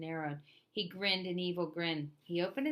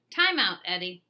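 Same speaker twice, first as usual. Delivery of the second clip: stern teacher; 10 dB louder than usual. A narrator's reading. Teacher sends Eddie away.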